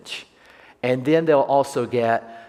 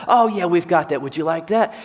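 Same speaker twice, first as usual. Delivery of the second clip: peppy barista; 6 semitones higher, 5 dB louder than usual. Retold or imitated.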